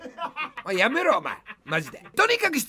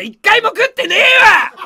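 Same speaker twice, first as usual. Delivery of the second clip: loud, higher comic retort; playful fake fury; mock angry denial; 6 semitones higher, 10 dB louder than usual. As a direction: snap the denial back. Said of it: "切れる生配信".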